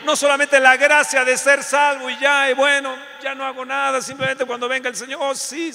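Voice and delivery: loud, high, shouted preaching; full-force preaching. Acted. Preacher shouting grace to the congregation, fervent. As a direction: cry it out over the congregation.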